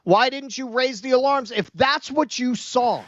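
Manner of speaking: high, insistent demand; indignant challenge. Real, not acted. Co-host demanding Clooney explain his silence, indignant. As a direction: pitch it up and demand an answer.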